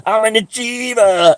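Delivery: high, loud, slow gloating cry; triumphant crowing. Retold or imitated. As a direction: crow it like you just won gold. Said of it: "and I go".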